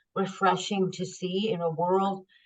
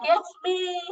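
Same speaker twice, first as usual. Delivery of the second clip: laughing; 12 semitones higher than usual. Retold or imitated. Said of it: "I was laughing".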